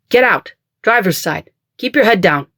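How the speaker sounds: urgent orders. A narrator's reading. Bodyguard ordering her out under fire, urgent shout.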